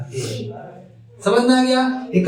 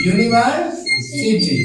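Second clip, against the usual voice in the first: University drawn out slowly, every syllable clear; slow model word; much slower than usual. Shown as a demonstration. Teacher slowly modelling the word for students. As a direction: spell out university slowly for the class. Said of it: "क्या पढ़ते हैं?".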